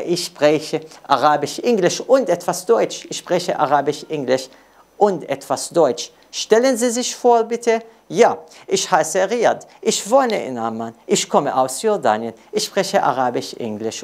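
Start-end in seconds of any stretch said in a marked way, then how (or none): none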